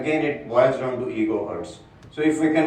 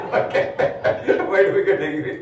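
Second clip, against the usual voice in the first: laughing; 4 semitones higher than usual. Real, not acted. Speaker to audience after joking about someone's wife, laughing. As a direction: speak through real laughter.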